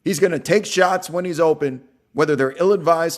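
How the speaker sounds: loud, high sports-call energy; loud commentary. Real, not acted. Commentator calling a player's shots, loud. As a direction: call it like a game announcer.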